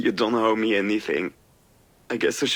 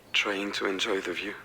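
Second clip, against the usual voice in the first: ordinary delivery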